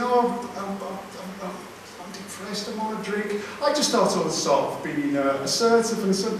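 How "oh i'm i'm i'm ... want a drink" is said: soft, mock-miserable mumble; mopey quote. Comic mocking AA members' mopey complaints, very soft. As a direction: mimic the glum group members. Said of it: "they were saying".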